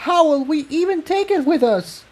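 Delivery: high, alarmed pony voice; a high, exasperated question; alarmed mare. Acted. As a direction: argue back in panic. Tiptoe arguing in alarm.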